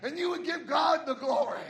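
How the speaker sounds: loud, shouted sermon climax; preaching at full force. Acted. Pastor urging the church not to give up, shouting. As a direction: shout it to the pews.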